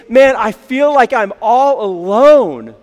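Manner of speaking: loud, drawn-out mock plea; pleading. Elijah in the cave pleads with God, pleading. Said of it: "he's just pleading with God".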